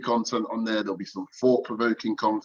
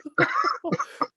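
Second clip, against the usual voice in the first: high-pitched laugh. Real, not acted. Laughing at the Joey post.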